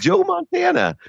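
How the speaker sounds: Joe Montana, squealed very high; name your idol in giddy disbelief; awestruck. Real, not acted. Awe that Montana asked him.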